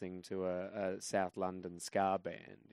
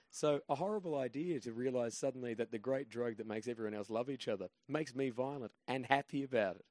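normal talking voice

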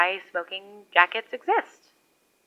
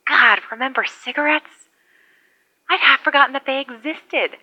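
higher, delighted rediscovery; excited; 7 semitones higher, 3 dB louder than usual. Real, not acted. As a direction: marvel at it, lifting up.

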